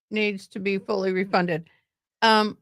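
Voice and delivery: ordinary talk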